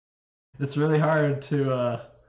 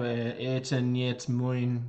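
slow attempt; much slower than usual. Shown as a demonstration. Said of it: "slow careful Russian attempt".

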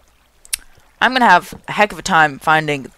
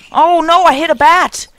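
high, louder oh no; real sudden dismay; 10 semitones higher, 7 dB louder than usual. Real, not acted. Accidentally hits a bat.

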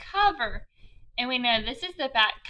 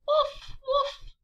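much higher, playful woof woof; bouncy high puppy barks for kids; 14 semitones higher than usual. Acted. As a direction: bark like a little puppy. Dog barks in picture book.

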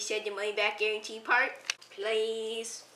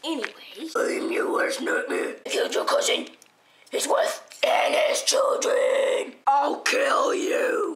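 menacing whisper; slower than usual. Acted.

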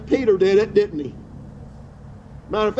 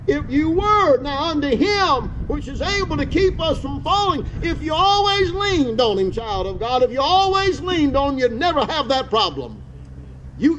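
rising preaching; 5 semitones higher than usual. Acted. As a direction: lift into a higher, ringing preaching voice. Preacher rises into higher preaching.

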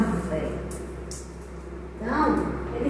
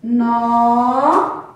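"No" held long as a separate syllable; first chunk "No", drawn out and set apart; 3 dB louder than usual. Shown as a demonstration. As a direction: stretch "No" out for the class. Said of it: "Vamos agora separar a palavra".

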